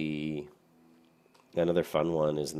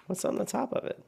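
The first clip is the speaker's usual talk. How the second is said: high surprised question. Real, not acted. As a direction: pitch up, curious and surprised. Surprised by odd in-game staff.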